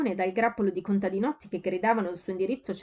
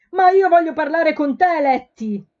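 much higher, much louder plea; farmer's son shouting; 8 semitones higher, 12 dB louder, slower than usual. A narrator's reading. Farmer's son shouting across the counter to Letty, loud. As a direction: shout over the counter at her. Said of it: "gridò il figlio di un agricoltore".

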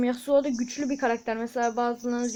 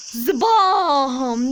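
mock hit sound; 7 dB louder than usual. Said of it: "zıbam diye vuruyoruz".

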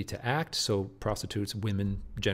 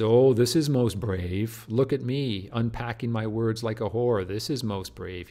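slow, louder mock pride; sarcastic; 6 dB louder, slower than usual. Teacher voicing Hamlet mocking himself, sarcastic. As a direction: sneer at his own bravery. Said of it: "So the sarcasm here".

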